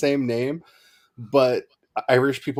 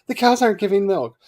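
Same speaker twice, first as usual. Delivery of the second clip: worried farmer; 10 semitones higher, 7 dB louder than usual. Retold or imitated. Telling of Saint Bridget's miracle, a worried villager, higher pitch. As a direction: voice of a fretting villager, higher and louder.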